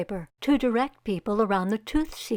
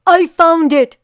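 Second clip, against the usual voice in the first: loud, high triumphant shout; father announcing the find, loud and high; excited shout; 7 semitones higher, 14 dB louder than usual. Acted. Father calling out discovery.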